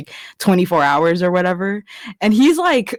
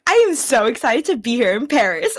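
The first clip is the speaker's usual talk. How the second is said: high, swooping mock enthusiasm; over-excited Emily; 5 semitones higher than usual. Quoting Emily's catchphrase, high mock excitement. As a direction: imitate a gushing, over-eager newcomer. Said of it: "She's like".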